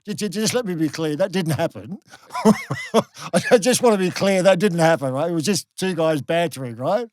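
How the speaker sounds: loud, laughing insistence that it never happened; half-laughing loud protest; emphatic denial. Real, not acted. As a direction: raise your voice and laugh it off, insisting hard. Insisting the rumour was false.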